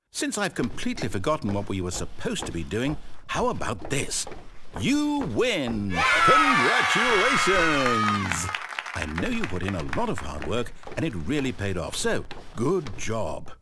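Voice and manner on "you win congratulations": loud, high, drawn-out congratulations; mock-triumphant cheer. Narrator congratulating Stanley on winning, triumphant cheer. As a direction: cheer it big like a game-show win.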